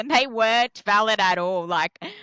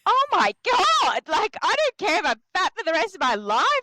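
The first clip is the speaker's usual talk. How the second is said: mock exasperated rant; 11 semitones higher, 4 dB louder than usual. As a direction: whine it in melodramatic despair.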